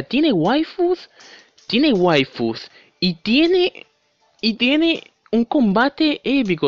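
high, slow, over-the-top enthusiasm; sarcastic praise. Real, not acted. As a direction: gush ironically about it. Ironic praise of bad game. (elogio sarcástico, agudo y lento)

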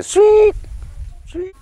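very high, short squeaks; tapir's small squeak. Retold or imitated. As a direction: imitate an animal's thin little cry. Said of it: "You just hear him going".

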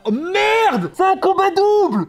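alarmed shout. Real, not acted. Alarmed at hidden trainers.

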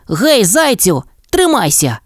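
high call to a friend. A narrator's reading. Mole calls to drowning hare, high urgent call. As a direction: mole shouting encouragement. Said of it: "крикнув кротик".

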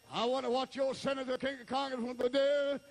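high, driving pulpit delivery; insistent revival preacher; full-force preaching. Real, not acted. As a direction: press on in a high preaching cadence.

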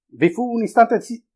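ordinary delivery

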